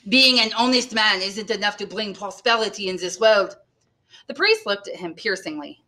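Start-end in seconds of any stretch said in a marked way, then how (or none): 0.1-3.6 lightly voiced aggrieved innkeeper; complaining innkeeper. A narrator's reading. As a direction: grumble mildly with a put-on accent.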